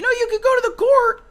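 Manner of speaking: mimicking wife. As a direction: pitch it up to mimic her. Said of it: "she, she's like".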